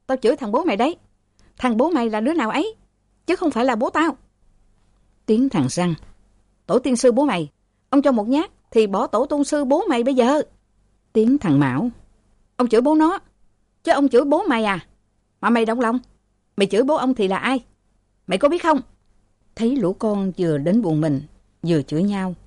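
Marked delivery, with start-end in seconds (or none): 0.1-4.2 high, fast abusive taunting; angry cursing. Acted. Sons cursing each other in quarrel. 6.7-10.4 high threatening curse; angry threat. Acted. Brother threatens in quarrel. 12.6-18.8 high, fast furious retort; angry retort. Acted. Brother curses back in quarrel.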